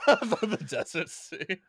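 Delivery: echoing the phrase through real laughter; laughing. Real, not acted. Host echoing the guest's joke, laughing. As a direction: repeat it while cracking up.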